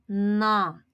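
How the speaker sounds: на with the opening н held long; lengthened н, then short а in на; drawn-out sound. Shown as a demonstration. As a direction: hum into the н of на. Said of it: "Перший склад".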